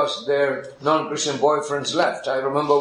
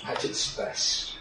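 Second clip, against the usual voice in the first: whispered aside; 9 dB quieter, slower than usual. Real, not acted. Tender rhetorical question.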